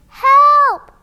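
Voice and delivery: put-on high loud baby shout; a baby's first shout, high and loud; baby's cry for help. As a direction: yell for help like a tiny child. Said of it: "her first word ever".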